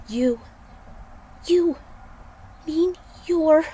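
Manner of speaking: a hint of a stunned heroine; stammering disbelief. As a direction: trip over the words in shock.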